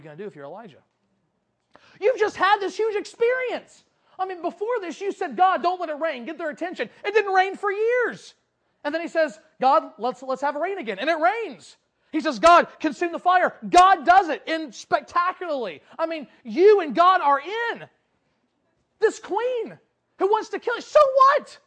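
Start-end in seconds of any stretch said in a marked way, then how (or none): 2.0-17.9 loud, high, impassioned preaching; forceful, incredulous preaching; rousing exhortation. Real, not acted. Preacher rousing listeners as if Elijah. 19.0-21.6 high, loud, dismissive outburst; incredulous cry building to a shout; scornful shout. Real, not acted. Preacher scoffs at the queen's threat.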